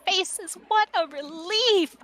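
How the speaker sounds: high, gushing relieved greeting; put-on high character voice, welcoming the interruption; Young Poems. Young book greeting new faces during a rant, relieved. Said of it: "Young Poem says".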